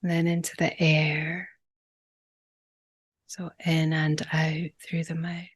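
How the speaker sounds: calm, unhurried breathwork guide with long pauses; gentle meditation leader, phrase by phrase; guided meditation. Real, not acted. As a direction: ease them into each breath, leaving lots of space.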